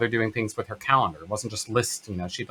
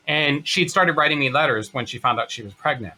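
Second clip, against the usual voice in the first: normal talking voice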